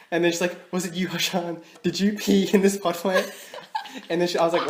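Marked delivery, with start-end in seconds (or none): 0.7-3.3 accusing mum. Retold or imitated. Mum accusing her in kitchen.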